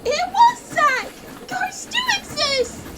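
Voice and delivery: high, slow frightened cry; panicked shriek of disbelief. Acted. Panicked after seeing ghost.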